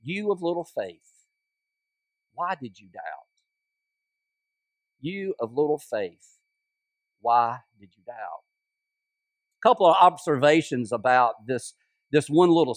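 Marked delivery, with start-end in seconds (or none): 0.0-8.4 gentle rebuke. Acted. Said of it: "then he says these words".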